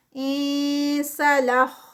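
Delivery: انسلخ drawn out with a hidden nasal نون; slow Quranic recitation. Shown as a demonstration. Teacher recites the word slowly for the class. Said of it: "خا heavy".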